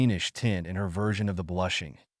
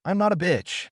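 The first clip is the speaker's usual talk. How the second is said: pitched-up, gritted-teeth denial; through clenched teeth; 8 semitones higher than usual. A narrator's reading. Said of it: "the girl answered with clenched teeth".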